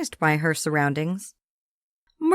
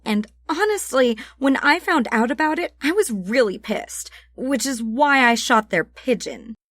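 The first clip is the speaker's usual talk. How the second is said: put-on higher, breezy tough-girl voice; offhand Sam; 5 semitones higher than usual. Sam waves off the murder accusation, breezy. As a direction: brush the accusation off.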